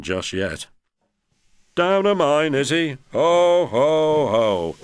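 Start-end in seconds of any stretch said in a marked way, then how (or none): 1.8-4.8 high, loud mocking ho ho ho; booming laugh. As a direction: laugh heartily at Thomas. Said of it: "laughed Gordon".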